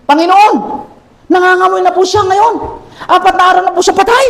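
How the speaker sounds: high, fast pleading as Martha; worried Martha. Acted. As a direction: voice Martha begging the Lord, pitched high. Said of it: "ngunit sumagot si Marta". (mataas at nagmamakaawang boses ni Marta)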